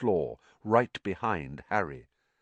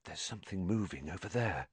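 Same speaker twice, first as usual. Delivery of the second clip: hushed, quick undertone; very soft, urgent warning; breathed; 10 dB quieter, faster than usual. A narrator's reading. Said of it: "Harry breathed".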